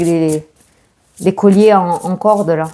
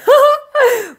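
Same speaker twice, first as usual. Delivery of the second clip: short high giggle; bright burst of laughter. Real, not acted.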